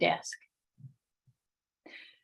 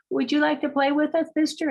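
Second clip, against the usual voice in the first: put-on sweet child's invitation; 7 semitones higher, faster than usual. Sister asks man sweetly.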